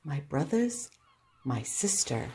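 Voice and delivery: ordinary delivery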